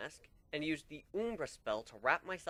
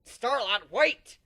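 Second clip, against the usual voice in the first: high, loud desperate call; shouting after her; 10 semitones higher, 10 dB louder, much slower than usual. Acted. Narrator chasing her friend out the door, high loud shout. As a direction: yell her name as she runs off. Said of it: "I shouted as I ran after her".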